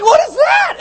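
very high, loud alarmed yell; hammed-up frightened cry; panicked shout. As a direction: shriek it in mock terror. Disciples see figure on lake.

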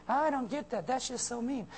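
high, soft mock whine; whiny complaint. Naive reader missing the Bible's sarcasm, whiny and high. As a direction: whimper like an offended reader. Mimics someone missing the irony.

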